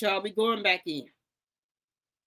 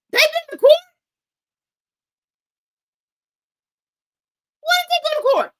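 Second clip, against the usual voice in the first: very high, very loud outraged shriek; shrieking disbelief; 15 dB louder, faster than usual. Real, not acted. Hearing the court claim, she shrieks in outrage. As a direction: scream the question in disbelief.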